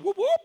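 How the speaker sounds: high siren whoop; imitated siren. DEA car pulling him over, siren imitation. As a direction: mimic a police siren chirp. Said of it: "I heard".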